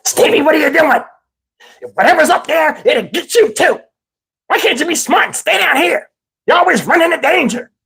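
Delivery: high, fretful scolding voice; high-pitched worried nagging; cowardly uncle. Retold or imitated. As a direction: imitate a frightened uncle telling off a child. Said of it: "He's such a coward".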